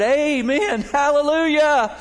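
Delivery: high, loud mock shout of hallelujah; shouted amen. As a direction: belt it from the closet. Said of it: "All of a sudden they heard".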